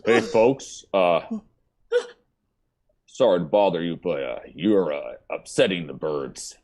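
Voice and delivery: low, polite official interrupting; polite park ranger. Acted. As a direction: deliver like a timid ranger.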